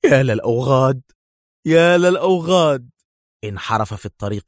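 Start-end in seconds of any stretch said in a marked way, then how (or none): 0.0-1.0 louder pained cry on يا; pained exclamation. A narrator's reading. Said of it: "ويهتف في ألم". 1.7-2.9 higher, louder cry of pain, stress on يا; anguished outcry; pained shout. A narrator's reading. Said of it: "ويهتف في ألم".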